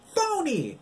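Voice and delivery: very high, loud jeer; yelling back. A narrator's reading. Girl yells the insult back, very high. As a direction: fling it back at her. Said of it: "yelled at the same time".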